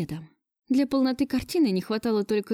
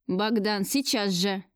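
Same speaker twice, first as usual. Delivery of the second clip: ordinary delivery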